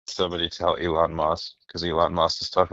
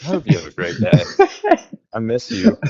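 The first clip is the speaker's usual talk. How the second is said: male Siri voice; 8 semitones higher, 5 dB louder than usual. Retold or imitated. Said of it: "I have the dude now, like".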